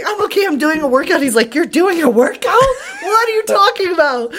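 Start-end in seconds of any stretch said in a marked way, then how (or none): 0.0-1.2 acted-out high, winded explanation; breathless reassurance. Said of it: "I'm breathing really hard". 1.5-4.3 high, incredulous parent impression; freaking-out dad. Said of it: "he's freaking out".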